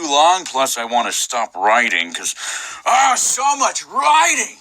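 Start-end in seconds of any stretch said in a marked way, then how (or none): 2.8-4.6 mock despair.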